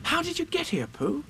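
put-on high-pitched boy's question; amazed boy. A narrator's reading. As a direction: ask in wonder as a little boy. Boy questions newly found Pooh.

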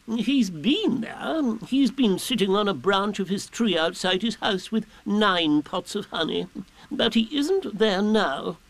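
high, slow, ponderous owl; Owl reporting. A narrator's reading. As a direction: deliver the report as a pompous owl.

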